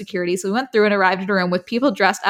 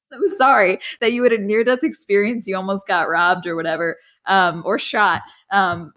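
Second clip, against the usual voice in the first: ordinary talk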